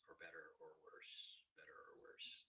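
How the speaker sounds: breathy whisper; whispered test. Shown as a demonstration. Streamer whispering to check if chat hears him. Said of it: "when I talk quietly".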